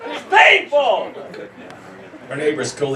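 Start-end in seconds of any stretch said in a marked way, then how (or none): 0.1-1.1 slow, loud and high, hammed-up cover story; dragged-out mock agony shout; fake pain yell. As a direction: bellow the excuse so the neighbor hears. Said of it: "I have to yell".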